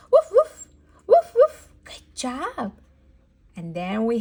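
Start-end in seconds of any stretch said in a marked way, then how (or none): 0.1-1.7 high, loud, short woofs; dog barking. Shown as a demonstration. Teacher showing what a dog says, barking. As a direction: yap like a dog, punchy and high. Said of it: "a dog says".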